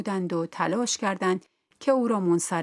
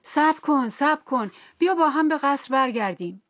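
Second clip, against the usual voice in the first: raised, higher-pitched urgent call; storyteller's acted cry to wait; 7 semitones higher, 4 dB louder than usual. A narrator's reading. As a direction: call after someone running away. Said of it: "فریاد زد".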